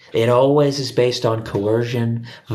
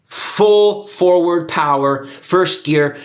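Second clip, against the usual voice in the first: loud, slow and drawn out; drawn-out rallying shout; forceful shout; 6 semitones higher, 4 dB louder, much slower than usual. Real, not acted. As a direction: bellow it like a war cry. Ranting climax, much louder.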